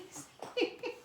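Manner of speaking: high airless giggle of disbelief; a quick unvoiced laugh of amazement; breathless giggle. Real, not acted. Reader laughing at the uncanny draw, high airless giggle.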